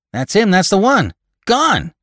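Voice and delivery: very high, loud exclamations; alarmed. A narrator's reading. Gravedigger discovering Lantry's body is gone, alarmed.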